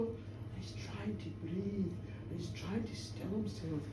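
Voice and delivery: soft, tense storytelling; hushed suspense narration. A narrator's reading. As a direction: draw listeners into the stillness. Storyteller evokes minister's dread.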